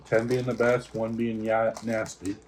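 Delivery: ordinary talk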